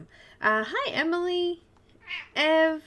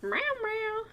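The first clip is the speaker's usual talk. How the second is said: meowing at the cat; 10 semitones higher, 4 dB quieter than usual. Pretend.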